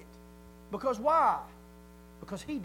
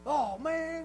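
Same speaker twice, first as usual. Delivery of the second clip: higher, drawn-out groan of "oh man"; mock dismay, pitched up; disappointed player; 5 semitones higher than usual. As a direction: mimic a player who just missed. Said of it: "and he goes".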